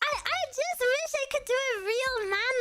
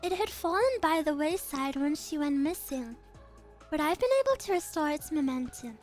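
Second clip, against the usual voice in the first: lower, measured and calm; composed noble girl; 6 semitones lower, 3 dB quieter than usual. Acted. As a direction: speak with quiet authority. Same character voice continues.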